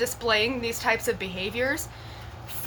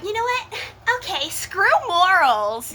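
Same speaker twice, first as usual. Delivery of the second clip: reckless defiance; 8 semitones higher, 5 dB louder than usual. Retold or imitated. Voicing her younger self giving in, loud mock defiance. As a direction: throw caution to the wind. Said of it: "But I was like".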